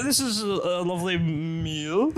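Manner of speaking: slow, overly careful dinner compliment; fake sober politeness. Tipsy guest faking sobriety at dinner, slow careful praise. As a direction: drag it out, trying hard to sound sober. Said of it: "pretend not to be drunk".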